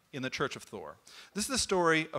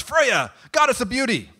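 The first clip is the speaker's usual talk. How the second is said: grand summons; 10 semitones higher, 9 dB louder than usual. Acted. Thor summoning Freya.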